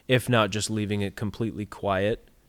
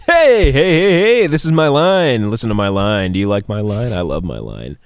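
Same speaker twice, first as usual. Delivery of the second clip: super emphatic; 8 dB louder than usual. Shown as a demonstration. Mock actor shouting to hog the spotlight. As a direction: shove the line in their face. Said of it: "super emphatically".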